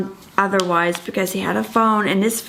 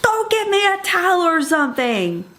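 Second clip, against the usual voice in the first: exasperated demand; 9 semitones higher than usual. Retold or imitated. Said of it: "And I'm like".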